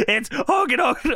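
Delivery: high, worked-up retelling; mock frantic Vince. Host acting out Vince yelling about Hogan, high. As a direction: ham up the boss losing it.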